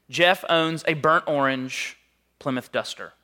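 ordinary talk, nothing marked

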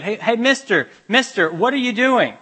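put-on high, loud eager kid calling out; child's voice. Boy calling to a cathedral builder, high and eager. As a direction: call up to the workman like an eager child.

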